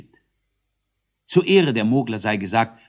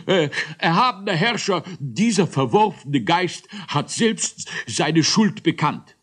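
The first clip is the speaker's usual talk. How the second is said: raised, snuffly voice of an accuser; stuffed-up judge; 9 semitones higher, 3 dB louder than usual. A narrator's reading. Judge with a cold accuses the ghost before the ruler, snuffly. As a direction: accuse through a badly blocked nose. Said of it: "verschnupfte und darum besonders dicknasige".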